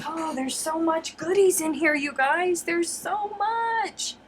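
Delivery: high gushing voice; thrilled by the goodies; excited. Real, not acted. Excited about box contents.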